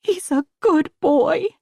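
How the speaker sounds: slowly insist, as if to believe it; slow pleading repeat; convincing herself. Acted. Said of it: "as if trying to convince herself".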